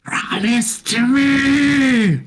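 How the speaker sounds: very high, slow wailing shout; Spectre's cry. Acted. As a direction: wail the claim like a denied ghost.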